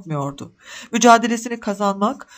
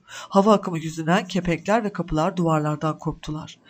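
plain reading voice

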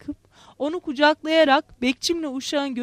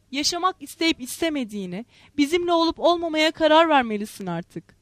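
plain reading voice

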